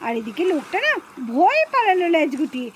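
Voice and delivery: low, easy narrator voice telling viewers the story; story narration. A narrator's reading. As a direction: narrate to the friends watching. (দর্শকদের কাছে গল্প বলা)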